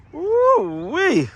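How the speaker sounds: high, drawn-out woo-wee; thrilled whoop at the wheels; excited woo-wee. Real, not acted. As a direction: let it fly up in delight. Admiring the finished car.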